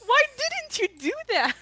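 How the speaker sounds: high squeaky imitation of a friend; imitating her friend. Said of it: "and she was like".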